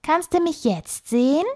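high squeaky little animal voice; tiny bossy critter, high and quick. Acted. The squirrel Flitzi teases Emma. (piepsige Stimme)

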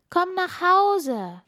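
high, slow, sing-song call; calling children. Acted. Star children calling Funkila home, high and slow.

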